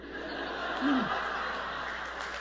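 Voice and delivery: airy, voiceless laughter; breathy laugh. Real, not acted. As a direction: laugh it out softly. Laughs after punchline.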